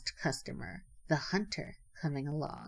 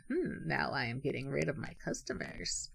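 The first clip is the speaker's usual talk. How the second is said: chuckling to himself; 4 semitones lower, 6 dB quieter than usual. A narrator's reading. Rabbit gloats privately over losing customers, chuckling to himself. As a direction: gloat quietly to yourself. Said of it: "laughing to himself, thought".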